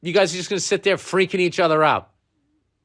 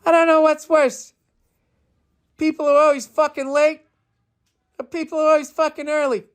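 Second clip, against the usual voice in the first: mock rant of his own joke, pitched way up; Bill Burr impression; 9 semitones higher than usual. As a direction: ham up a parody of yourself, yelling it way up high. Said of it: "my impression of people's impression of me".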